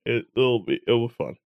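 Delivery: ordinary talk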